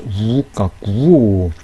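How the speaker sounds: very slow 우까꾸오 with a tense kk between the vowels; clear pronunciation. Shown as a demonstration. Teacher separating vowels for students, slow clear pronunciation of 우까꾸오. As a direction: stretch 우까꾸오, each vowel long and distinct. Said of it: "발음을 분명하게 해주기 위해서".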